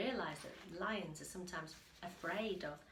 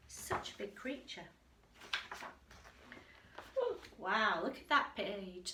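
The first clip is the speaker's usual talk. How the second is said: ordinary delivery, nothing marked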